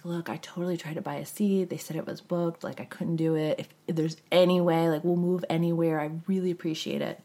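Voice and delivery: quiet, humble request; soft pleading. Retold or imitated. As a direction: ask softly, very polite. Same plea to the steward.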